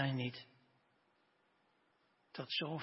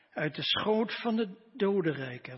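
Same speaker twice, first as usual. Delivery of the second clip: raised, louder fervent Bible reading; dramatic scripture reading; 4 semitones higher, 4 dB louder than usual. Acted. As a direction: proclaim the psalm with rising fervour. Dramatic reading of Jonah's prayer.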